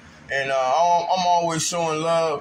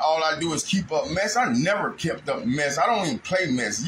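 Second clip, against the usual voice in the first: usual speaking voice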